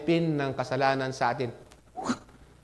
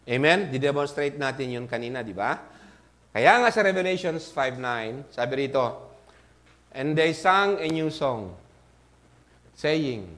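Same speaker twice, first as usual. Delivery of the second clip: usual speaking voice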